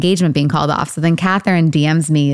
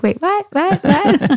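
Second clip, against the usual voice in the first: high, disbelieving repeated whats; mock shock; 11 semitones higher, slower than usual. Friend reacting to a dinner invite, high mock shock. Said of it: "She's like".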